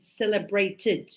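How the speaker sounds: celebrated ending in an extra syllable id. Shown as a demonstration. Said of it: "cuando pronuncio en pasado".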